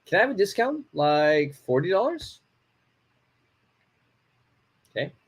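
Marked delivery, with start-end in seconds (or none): 0.1-2.4 "Can I have a discount" lifted high and rising; rising polite tune. Shown as a demonstration. Teacher demoing a polite rising question. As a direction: ask with a bright upward lilt on "dollars". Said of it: "it all matters on your intonation".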